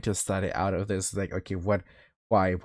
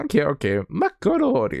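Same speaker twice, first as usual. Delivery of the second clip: mimicking own voice; 5 semitones higher than usual. Imitating own clip.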